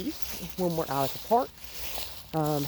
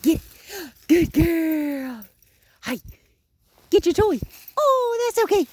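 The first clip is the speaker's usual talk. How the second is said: much higher, louder yays; excited cheering; 12 semitones higher, 8 dB louder than usual. Real, not acted. Trainer cheering the puppy on with yays. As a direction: cheer like she won a prize.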